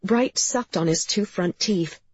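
ordinary delivery

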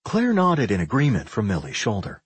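ordinary delivery

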